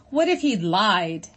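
slow, loud other-animal voice; howled. Acted. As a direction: howl the doubt out slowly. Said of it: "howled a voice".

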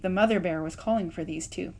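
plain reading voice

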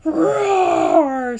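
loud, high roaring cry; bear roar. A narrator's reading. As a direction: roar like an angry young bear. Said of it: "said the sister bear".

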